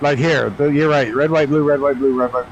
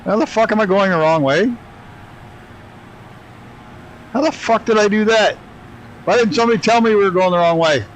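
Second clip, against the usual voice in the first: can't believe the mistake, voice pitched up; exasperated disbelief; 8 semitones higher than usual. Real, not acted. Streamer realises he flew wrong way.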